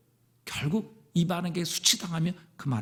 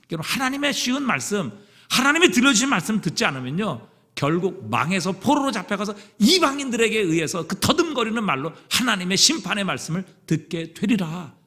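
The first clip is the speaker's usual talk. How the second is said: fervent preaching; 5 semitones higher, 5 dB louder than usual. Acted. Preaching at full force.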